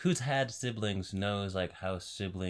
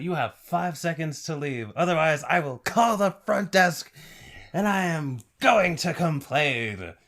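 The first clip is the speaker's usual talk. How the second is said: building to a high shrieking countdown, louder each number; mock-outraged countdown ending in a shout; furious countdown; 8 semitones higher, 9 dB louder than usual. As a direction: threaten him, then scream the count. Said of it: "I love that scene so much".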